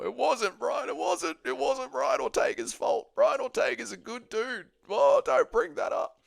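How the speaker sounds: high, whiny-pitched mimicry of online fans; slow, high mock-protest voice; mocking the chat. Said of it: "Now watch the chat go".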